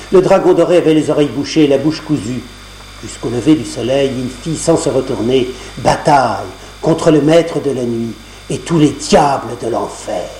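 usual speaking voice

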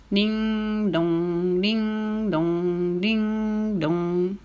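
sung, ringing ding-dong; sustained two-note bell tolling; imitated clock chime. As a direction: chime like a big church clock. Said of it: "sonna la grosse horloge".

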